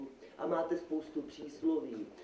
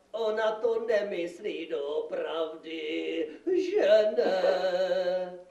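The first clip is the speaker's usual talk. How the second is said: drawn-out wobbly plea, louder at the end; nervous pleading; 2 semitones higher, 5 dB louder, much slower than usual. Acted. Magistrate pleads that she doesn't mean it, drawn out. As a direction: stretch it out, hoping for agreement.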